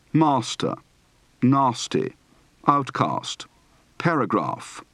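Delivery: long ah in "grass", "half", "laugh"; word list with "master" as MAH-stuh; open-throated ah in "nasty"; broad open-throated A. Shown as a demonstration. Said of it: "Practice for the broad, open-throated A".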